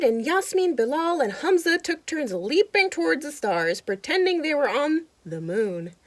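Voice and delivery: lively storybook narration; animated read-aloud for kids; reading to children. A narrator's reading. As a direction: read it like a picture book to a child. Reading picture book aloud.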